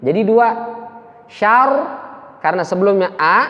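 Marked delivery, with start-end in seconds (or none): none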